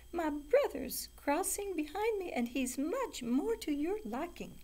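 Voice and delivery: very high tiny goat voice; squealing little goat. A narrator's reading. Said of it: "squealed the youngest billy goat gruff".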